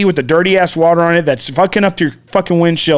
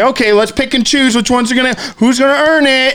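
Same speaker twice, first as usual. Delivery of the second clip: high, lightly voiced sneering giver; mock snooty giver; 8 semitones higher than usual. Mocking people who pick who earns help, sneering high. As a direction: parody people choosing who deserves help.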